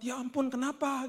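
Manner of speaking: high, imitated shocked friend; gasp-like concern from a friend hearing bad news; kaget. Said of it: "Temannya kaget". (menirukan teman terkejut, nada tinggi)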